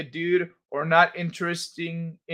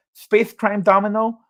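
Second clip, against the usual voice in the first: slow, lisped attempt at a title, higher; Mike Tyson; 4 semitones higher, 3 dB louder than usual. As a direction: sound it out with a Tyson lisp. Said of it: "I can almost say that one".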